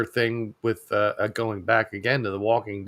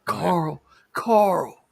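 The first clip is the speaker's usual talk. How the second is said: mock-anguished calls climbing higher and higher; drawn-out cry of a name, pitched up in stages; parody Carl call; 10 semitones higher, much slower than usual. Co-host mimicking the Walking Dead father calling his son, mock-anguished calls. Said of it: "those parody clips of Walking Dead".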